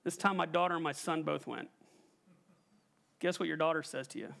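usual speaking voice

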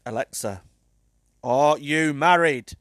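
loud, slow, deliberate question. Real, not acted. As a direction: spell it out for a deaf gadget. Repeating unheard question louder.

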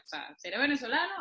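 ordinary talk, nothing marked

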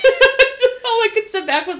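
words pushed through laughter; laughing. Real, not acted. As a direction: say it while cracking up. Laughing at the retold text.

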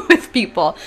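laughing. Real, not acted. Laughing at how texting fails friends, high and loud.

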